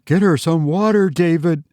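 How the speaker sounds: high, urgent tearful mother; mildly sobbing. A narrator's reading. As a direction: plead for help with a touch of tears. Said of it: "sobbed her mother".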